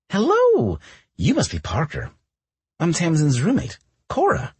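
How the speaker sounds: high, loud cheery welcome; bright greeting. Acted. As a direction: greet him brightly, overly eager. Cora greeting the guest at door.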